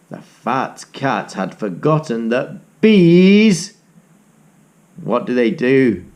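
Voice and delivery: usual speaking voice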